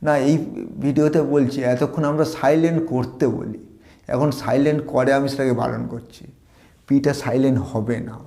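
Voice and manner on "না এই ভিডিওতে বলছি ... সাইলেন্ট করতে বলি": normal talking voice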